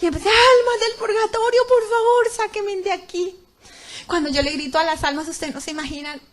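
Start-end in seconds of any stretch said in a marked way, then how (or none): 0.3-3.3 high, loud plea for rescue; desperate shout. Retold or imitated. Woman in the pit begs souls in purgatory, desperate shout. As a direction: cry out for help, stretching the last words. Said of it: "empecé a gritar".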